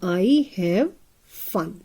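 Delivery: drawn-out I have; slow model reading. Shown as a demonstration. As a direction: stretch "I have" so children hear each sound. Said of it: "अ की sound है".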